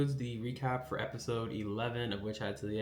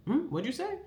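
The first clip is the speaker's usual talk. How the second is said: much higher, sugary threat; menacing sweet question. Retold or imitated. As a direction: ask it as a veiled warning to shut up. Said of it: "tells him to shut the hell up by going like".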